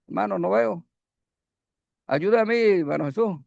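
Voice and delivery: ordinary talk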